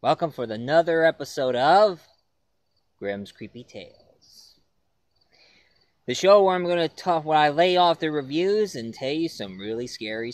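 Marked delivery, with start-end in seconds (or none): 3.0-4.5 spooky title. Acted. Show title said low and slow.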